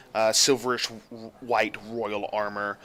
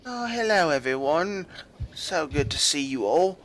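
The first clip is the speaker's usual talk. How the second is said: lightly voiced old senator; 5 semitones higher than usual. Old senator greets guards.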